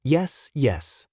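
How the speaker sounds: nervous, unsteady yes; trembling voice. A narrator's reading. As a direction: let the voice quiver as you give in. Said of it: "my voice trembled uncontrollably".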